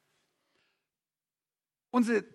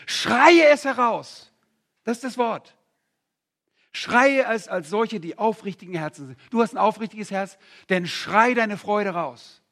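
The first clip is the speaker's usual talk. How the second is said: preaching; 6 dB louder than usual. Acted. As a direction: urge them to cry out their joy. Exhorts congregation to shout.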